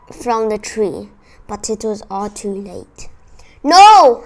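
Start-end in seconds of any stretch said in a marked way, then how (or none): 3.6-4.2 high, loud, drawn-out shout; horrified storyteller's yell; anguished cry. A narrator's reading. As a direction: cry out to stop a disaster. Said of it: "I cried".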